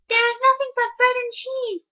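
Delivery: a hint of a sly farm wife; miller's wife voice.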